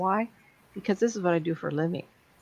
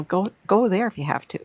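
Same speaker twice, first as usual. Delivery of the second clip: ordinary delivery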